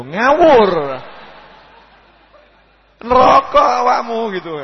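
loud, high mock rebuke; scolding outburst. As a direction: shout down the impudent worshipper. Rebukes the imagined insolent prayer.

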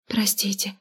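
very soft embarrassed whisper; embarrassed mumble. Acted. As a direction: murmur an abashed apology. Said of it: "пробормотала я смущённо".

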